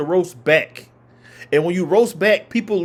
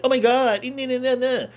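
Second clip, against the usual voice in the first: higher, valley-girl Becky impression; 7 semitones higher than usual. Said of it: "talking like a Becky".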